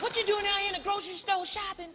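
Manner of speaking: high, puzzled question; a fan surprised to see a star shopping; curious fan. Retold or imitated. Fans questioning Chris in the grocery store, high and puzzled. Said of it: "They be like".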